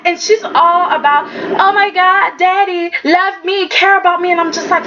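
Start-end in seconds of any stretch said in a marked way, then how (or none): 1.6-4.3 high-pitched clingy daughter; hammed-up neediness; mock needy begging. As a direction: beg for daddy's love in short pleas. Acts needy daughter begging daddy.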